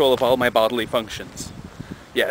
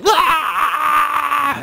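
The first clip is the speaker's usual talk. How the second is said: high mock child shriek; kids screaming; 14 semitones higher, 4 dB louder than usual. Teacher imitating kids running wild in class, high shriek. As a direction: shriek like a wild five-year-old.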